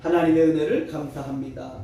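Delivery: ordinary talk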